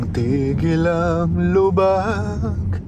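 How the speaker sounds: slow, drawn-out words jolted by bumps; on a bumpy road. Real, not acted. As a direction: let the bumps shake the words.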